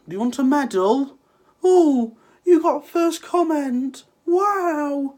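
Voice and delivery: mock the first commenter with fake awe; sarcastic mock praise.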